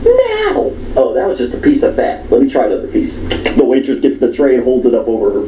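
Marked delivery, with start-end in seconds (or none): 0.0-0.7 high mock squeal; mock shrill customer. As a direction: squeal it out like the fussy woman. Retelling a picky customer.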